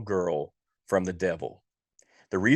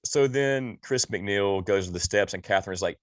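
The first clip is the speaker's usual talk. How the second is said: ordinary delivery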